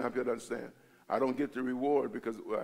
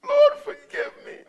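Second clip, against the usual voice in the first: loud, very high tearful prayer; anguished high plea to God; crying plea; 12 dB louder than usual. Retold or imitated. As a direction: cry out for forgiveness. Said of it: "So I'm crying".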